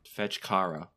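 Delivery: ordinary talk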